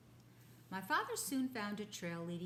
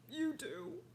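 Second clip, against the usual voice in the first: much higher, louder with a laugh; excited rhinoceros; 11 semitones higher, 11 dB louder than usual. Acted. Rhinoceros hearing his tusk can be saved, excited. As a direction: burst with disbelieving joy.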